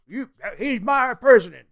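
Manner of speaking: loud, high hammed-up outburst; mock angry supporter. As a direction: imitate a riled-up fan shouting down criticism. Mocking an unthinking Trump supporter.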